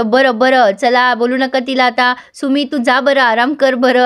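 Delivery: plain reading voice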